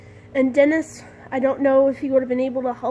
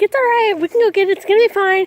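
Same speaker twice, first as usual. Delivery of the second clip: higher, reassuring dad voice; 6 semitones higher, 5 dB louder, faster than usual. Retold or imitated. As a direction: soothe her like a calm dad. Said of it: "Dad was like".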